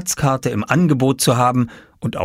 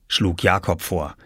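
usual speaking voice

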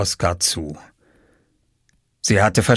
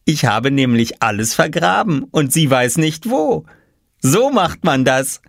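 put-on high, gleeful boy's voice; triumphant; 6 semitones higher than usual. A narrator's reading. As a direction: show off the clever scheme. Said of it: "erklärte Oskar triumphierend". (triumphierend, hohe Jungenstimme)

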